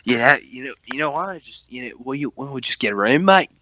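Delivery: lightly voiced British bloke, flirty; a hint of a British pub lad inviting him home; mock British accent. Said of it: "focus too much on the accent".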